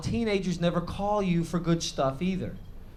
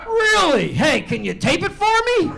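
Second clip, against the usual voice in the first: mock excited teenager, much higher and louder; son's excited voice; 13 semitones higher, 8 dB louder than usual. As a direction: play the clueless son thrilled to be on TV. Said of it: "He's like".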